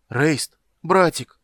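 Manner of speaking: high, pleading shout; desperate calling. Acted. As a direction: call out to a brother in despair. Abandoned brother calls out desperately.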